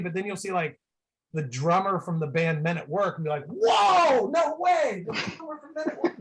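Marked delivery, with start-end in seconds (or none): 3.5-6.2 geek out loudly, voice shooting way up; over-the-top amazed fan voice; starstruck shout. Retold or imitated. Acts out spotting an obscure celebrity.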